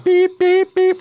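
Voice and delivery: high, loud smoke alarm beeping; loud alarm beeps. Retold or imitated. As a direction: imitate a piercing smoke alarm. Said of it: "real loud".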